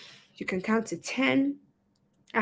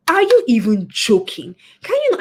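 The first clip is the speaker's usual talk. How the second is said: loud exasperated mock outburst, pitch jumping up; half-acted furious disbelief; 2 semitones higher, 8 dB louder than usual. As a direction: imitate the angry blowup you held back. Wanted to explode at the bank.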